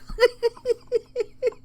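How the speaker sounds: helpless high-pitched laugh; high burst of laughter. Real, not acted. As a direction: breaks into a high cackle at the joke. Cracking up at the joke.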